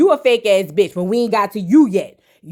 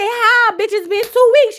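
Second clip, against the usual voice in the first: much higher, stunned "how?" at a friend; shocked disbelief; 11 semitones higher than usual. Retold or imitated. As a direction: squeal it like you can't believe it. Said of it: "And I said".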